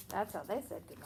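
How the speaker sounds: usual speaking voice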